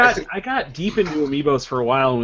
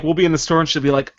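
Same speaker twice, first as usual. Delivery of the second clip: normal talking voice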